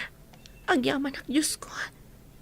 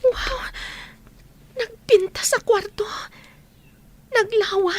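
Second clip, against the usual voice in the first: amazed; 7 semitones higher, slower than usual. Acted. Mother exclaiming over the big room, amazed. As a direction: burst out in wonder.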